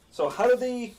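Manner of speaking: usual speaking voice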